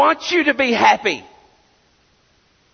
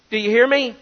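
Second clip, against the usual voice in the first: ordinary delivery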